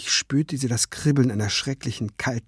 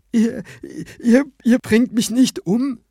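stammering disbelief; 12 semitones higher, 4 dB louder than usual. Acted. Sobbing man asks in disbelief.

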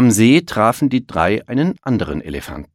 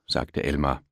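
usual speaking voice

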